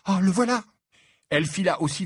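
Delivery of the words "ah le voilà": high, louder startled cry; alarmed. Acted. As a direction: blurt it on spotting someone to avoid. Hermione spots Cormac coming.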